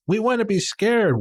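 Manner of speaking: put-on high, slow little kid voice. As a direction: pipe it up like an eager child.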